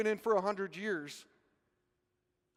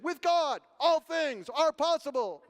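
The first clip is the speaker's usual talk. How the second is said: loud, high, slow congregational chant; choir-like response; 8 semitones higher, 7 dB louder, slower than usual. Retold or imitated. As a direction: proclaim it together like a choir. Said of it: "It was like a choir".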